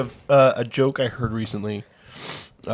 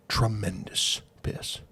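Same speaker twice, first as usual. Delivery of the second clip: slow savoring; 6 dB quieter, slower than usual. Acted. Third old guy savoring boast.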